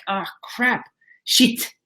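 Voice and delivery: imitated French accent, pitched high. Coach imitating client who saw the truth, French accent. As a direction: mimic a Frenchman's rueful cursing, high-voiced. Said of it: "He has a French accent".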